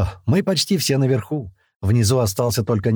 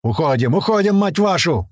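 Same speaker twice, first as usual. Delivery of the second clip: urgent raised-voice order; moderately loud, higher yell; shouting; 6 semitones higher, 3 dB louder than usual. A narrator's reading. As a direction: shout the retreat, climbing higher on the curse. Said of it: "крикнул Туман".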